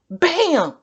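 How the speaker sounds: high, loud "bam"; crash sound effect. Acted. Reader voicing Gary hitting the wall, loud bam. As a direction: slam the crash out.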